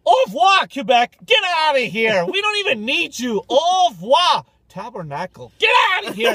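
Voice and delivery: loud, high ranting yell at Quebec; rant, hammed-up fury; mock rant.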